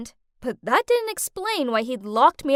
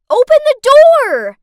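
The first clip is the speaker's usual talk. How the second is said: high, very loud scream; 12 semitones higher, 16 dB louder than usual. A narrator's reading. Captive banging on the locked door, high desperate scream. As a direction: scream it out as a demand. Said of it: "banged on the door and screamed out".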